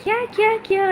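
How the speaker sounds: surprised. Real, not acted. Reacting to a viewer comment.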